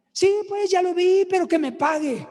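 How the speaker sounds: high, indignant señora voice; insistent woman. Retold or imitated. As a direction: imitate a stubborn woman demanding payment. Retold señora insisting on payment.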